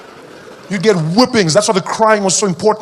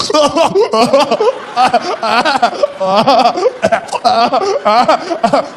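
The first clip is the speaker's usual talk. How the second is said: high, gasping mock sobs; exaggerated breath-catching fake crying; choking cry; 6 semitones higher than usual. Shown as a demonstration. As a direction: heave and gulp as if choking. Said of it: "jump in with a choking cry".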